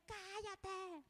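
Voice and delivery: high, drawn-out "shut up"; mock yell. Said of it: "le grita así".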